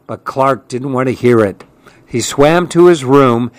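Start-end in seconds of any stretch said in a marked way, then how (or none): none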